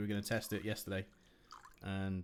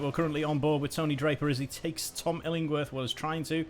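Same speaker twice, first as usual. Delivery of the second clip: energetic play-by-play, louder; live race commentary; 6 semitones higher, 7 dB louder than usual. Acted. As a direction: call the race as it unfolds.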